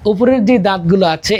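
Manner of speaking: usual speaking voice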